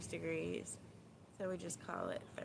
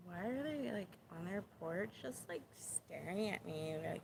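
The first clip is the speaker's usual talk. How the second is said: put-on Valley Girl accent, dragging out "upset"; 5 dB quieter than usual. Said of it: "That's my Valley Girl accent".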